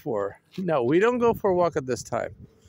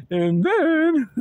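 losing voice; 10 semitones higher, 4 dB louder than usual. Real, not acted. [name] losing voice in the cold, failing voice. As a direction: voice giving out on a drawn-out THEN. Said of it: "I'm losing my voice".

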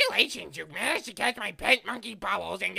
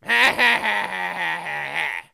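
short laugh; 5 dB louder than usual. Acted. Laughing at a silly cause of death.

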